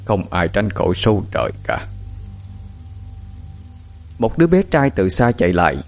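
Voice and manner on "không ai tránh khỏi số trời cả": put-on understated, fading old man's voice; old sage. A narrator's reading. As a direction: muse on fate like a weary old master. Old mystic sighs about fate.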